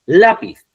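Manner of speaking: "lápiz" with a loud, high LA; emphatic LA-piz; stress on first syllable. Shown as a demonstration. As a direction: punch the first syllable of "lápiz", then fall. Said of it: "Lleva la sílaba tónica en la letra A".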